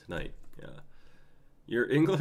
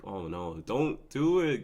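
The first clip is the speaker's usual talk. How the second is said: ordinary delivery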